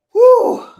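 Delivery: high loud relieved whew. Real, not acted. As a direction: blow it out big. Loud exhale.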